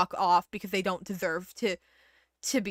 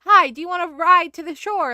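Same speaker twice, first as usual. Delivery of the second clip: higher, louder cheerful offer; friendly rescuer; 5 semitones higher, 8 dB louder than usual. Retold or imitated. Second boat calling to stranded person, higher and brighter. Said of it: "and it's like".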